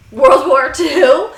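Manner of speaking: loud, high, drawn-out question; loud disbelieving question; mock shock. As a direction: blurt it slowly, high and rising. Acted shock at hearing of war.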